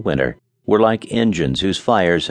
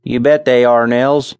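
Charlie's voice; 4 semitones higher, 5 dB louder than usual. A narrator's reading. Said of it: "replied Charlie".

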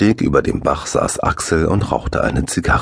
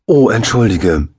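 high, louder alarmed sorry; anxious apology; 5 semitones higher, 5 dB louder than usual. A narrator's reading. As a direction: blurt out a worried apology. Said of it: "rief Axel ängstlich".

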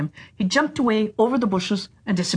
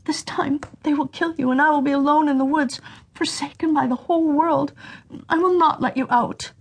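crying sister; 7 semitones higher than usual. A narrator's reading. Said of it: "Crying, the sister said".

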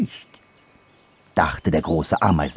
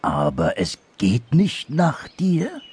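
slow, raised question; tentative; 6 semitones higher, slower than usual. Acted. Anteater questioning the dormouse, slow tentative voice. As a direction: ask carefully, word by word.

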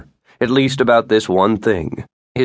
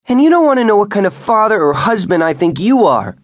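higher, louder, fast furious retort; angry; 13 semitones higher, 6 dB louder, faster than usual. A narrator's reading. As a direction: throw it back at him hard. Couple's heated quarrel.

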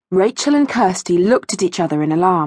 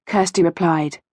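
usual speaking voice

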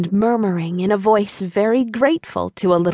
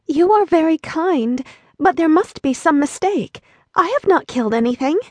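put-on higher young girl voice, a touch unsure; lightly voiced Dorothy, hesitating; hesitant girl; 7 semitones higher than usual. A narrator's reading. Dorothy answering the old woman, lightly hesitant. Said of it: "with hesitation".

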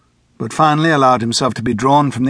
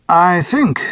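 lightly raised, polite chairman voice; a courteous chairman, a touch higher; 6 semitones higher, 4 dB louder than usual. A narrator's reading. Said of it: "the chairman said".

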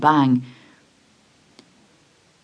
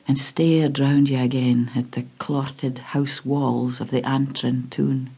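plain reading voice

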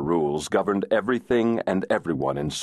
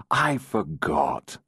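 purring; much slower than usual. Acted. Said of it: "Adam purred".

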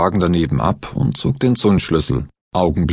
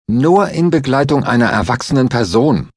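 smirking tease; 6 semitones higher, 3 dB louder than usual. A narrator's reading. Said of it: "feixte Stahl".

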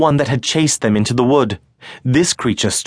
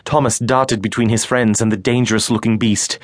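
normal talking voice

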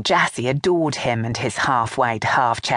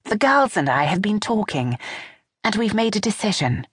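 lightly voiced younger woman, a little higher; a hint of a nervous daughter-in-law; Vanessa voice; 8 semitones higher than usual. A narrator's reading.

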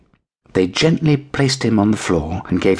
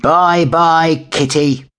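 higher, louder taunting bye-bye; mocking shout; 10 semitones higher, 4 dB louder, much slower than usual. A narrator's reading. Big cats shouting goodbye to the kitten. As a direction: jeer it after him. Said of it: "shouted the two large cats".

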